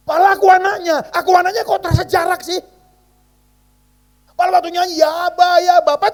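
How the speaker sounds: high, loud pleading sermon; impassioned preaching. Acted. Preacher telling the congregation of his distance from God, impassioned. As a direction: press the point with rising fervour.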